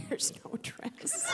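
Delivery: whispered, slow and deflated; deadpan whisper. Retold or imitated. Daughter whispering to mom, slow deadpan. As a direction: let the air out, barely voiced.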